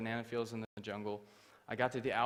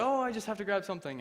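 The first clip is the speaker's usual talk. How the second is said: high, louder innocent excuse; feigned innocence; 9 semitones higher, 6 dB louder than usual. Retold or imitated. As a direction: play it innocent in a raised pitch. Caught prisoner answers officer innocently.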